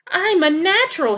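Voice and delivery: high squeaky gosling bragging; squeaky boast. A narrator's reading. Proud gosling brags to Roz about flying, high squeak. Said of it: "he squeaked".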